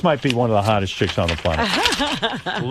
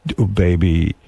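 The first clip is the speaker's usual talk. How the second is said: low, mock-seductive croon; phone-sex sweet talk; 5 semitones lower than usual. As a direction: play a lover starting phone sex, pitched down. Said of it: "And then you go".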